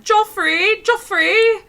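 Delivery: high, loud, bossy call for Joffrey; shrill-free high summons, drawn out on slippers; imitating Nancy Landgraf. Nancy bossing Joffrey about his slippers, high imperious call. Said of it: "Nancy Landgraf being like".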